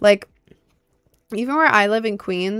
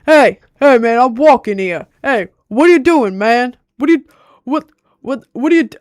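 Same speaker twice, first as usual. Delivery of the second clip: loud, raised New York street shout; mock New York yelling; 4 semitones higher, 8 dB louder than usual. Imitating yellers out in the streets, loud New York accent. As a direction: yell indignantly in a hammed-up New York accent. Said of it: "out in the streets yelling have the accent".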